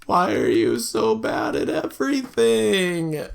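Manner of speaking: high, drawn-out mock wail; mock exasperation. Player wailing at Brody for matching with nobody, mock exasperated. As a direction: scold him in a high, exaggerated complaint. Scolds game character for matching badly.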